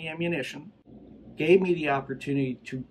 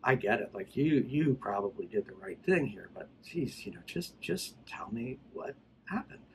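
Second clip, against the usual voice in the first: soft, coaxing imitation of a friendly cop; quiet buddy-to-buddy persuasion, trailing softer; really nice; 4 semitones lower, 5 dB quieter than usual. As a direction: act out gently winning his confidence. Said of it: "he got really nice".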